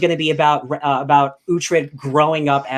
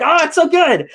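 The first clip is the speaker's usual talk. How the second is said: high, loud gushing exclamation; overjoyed outburst of praise; ecstatic gush; 13 semitones higher, 5 dB louder than usual. Retold or imitated. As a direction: rave like a thrilled fan. Gushing over a great scene.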